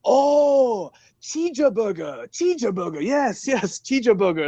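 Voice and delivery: chirpy mock Korean clerk catching on; imitated Korean accent. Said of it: "And they're like".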